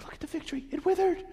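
high, breathless wonder; high amazed Peter voice. Retold or imitated. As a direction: point and marvel like a kid. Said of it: "Peter's like".

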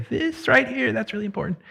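high, softened note of emphasis; emphasizing importance. Acted. Preacher showing how people flag what matters, high and soft emphasis. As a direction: point it out high-pitched and hushed. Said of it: "You're trying to say".

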